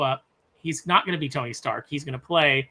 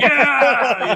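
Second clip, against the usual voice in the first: loud laughing whoop, higher; mock crowd cheer; 7 semitones higher, 9 dB louder than usual. Imagining a crowd of Dooms cheering, laughing whoop. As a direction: cheer like a hyped arena of Dooms. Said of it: "They're gonna be like".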